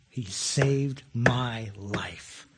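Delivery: slow, breathless whispered devotion; whispered heartfelt testimony; awed whisper. Retold or imitated. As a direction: hush it like a confession of love. Believer's heartfelt answer.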